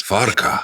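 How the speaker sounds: "Farquhar" as "FAR-ka" with a rolled R. Shown as a demonstration. Co-host attempts the Scottish name, rolling the R in "Farquhar". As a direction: roll the R in "Farquhar", dropping the W. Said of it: "I'm rolling an R for no reason".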